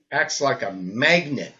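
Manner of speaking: usual speaking voice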